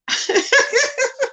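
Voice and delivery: high giggling burst; real laughter. Real, not acted. Streamer laughs that something is wrong with him, real laughter. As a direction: laugh helplessly for a moment. Cracking up over the rival.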